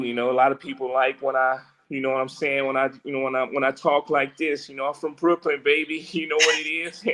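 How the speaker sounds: lightly laid-back swagger, boasting about Brooklyn. Retold or imitated. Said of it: "I can do a little [name] too".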